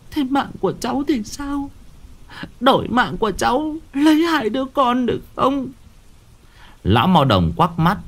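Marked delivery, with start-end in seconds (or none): 0.1-5.7 high, thin, desperate begging; pleading mother. Acted. Mother begging the shaman to take her life instead. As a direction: offer your own life, voice straining high.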